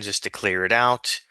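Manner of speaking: ordinary talk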